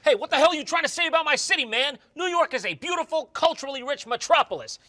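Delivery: high, fast indignant protest; mock offended. New Yorker defending his city, mock offended.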